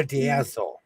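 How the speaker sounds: put-on "bedazzle" lightly in a Minnesota accent; a mild Minnesota twang on "bedazzle". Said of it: "That's me in my Minnesota".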